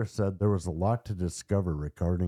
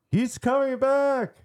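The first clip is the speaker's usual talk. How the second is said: very high, louder mock scream; 6 dB louder than usual. Narrator imitates possessed boy's second, louder cry, screaming out. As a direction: repeat the alarm louder and higher still. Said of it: "started screaming out".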